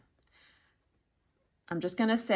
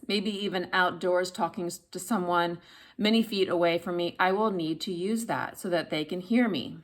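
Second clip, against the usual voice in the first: ordinary delivery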